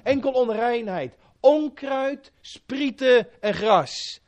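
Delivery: earnest pleading prayer to God; fervent prayer. Acted. Preacher praying aloud before congregation, earnest pleading. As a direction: pour out the prayer humbly.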